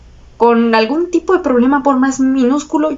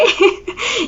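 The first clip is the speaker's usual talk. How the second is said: short higher laugh; embarrassed laugh; 8 semitones higher, 3 dB quieter than usual. Real, not acted. Admitting a mistake to the clerk, embarrassed giggle.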